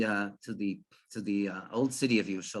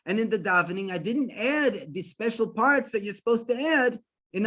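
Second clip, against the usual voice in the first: higher, agitated fretting; disturbed; 6 semitones higher than usual. Retold or imitated. Farmer frets to himself about the missed fast, agitated. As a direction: act out a rising fluster of dismay. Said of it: "all of a sudden disturbed".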